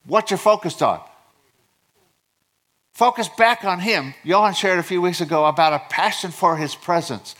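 high, urgent preacher delivery; impassioned preaching. Real, not acted. Pastor urging congregation to focus back on God, impassioned preaching. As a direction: preach it with rising fervor.

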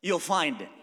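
loud, high, driving pulpit cry; full-force sermon climax; fiery preaching. Acted. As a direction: push the point home to the crowd. Preaching crescendo.

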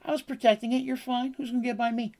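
lightly voiced sly sorceress; Karina's high voice. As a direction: brush off their worry from up high. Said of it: "She's like".

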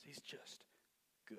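unvoiced reverent whisper; hushed awe. Real, not acted. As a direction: breathe it in awe.

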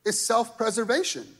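usual speaking voice

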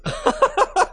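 pained laugh; laughing through pain. Real, not acted. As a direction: laugh that hurts. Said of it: "it hurts to laugh".

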